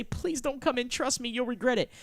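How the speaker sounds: high-pitched acted plea; pleading. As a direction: beg her to keep out. Acts begging her to stay out.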